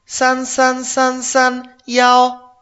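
slow and loud, each digit spaced; careful teacher dictation of a phone number; slow model sentence. Shown as a demonstration. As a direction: dictate the number clearly to a learner. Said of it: "directamente diríamos".

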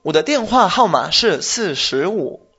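slow, careful model sentence, every syllable placed; teacher slows right down so the learner can follow. Shown as a demonstration. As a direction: show the student how slowly to say it. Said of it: "Hazlo lento".

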